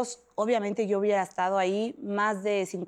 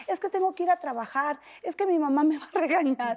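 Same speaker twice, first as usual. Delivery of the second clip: put-on higher, pleading like a scared girl; a mild girlish whine about mom scolding; childish plea; 6 semitones higher than usual. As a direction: beg to go home to work. Retells her pleading as a girl.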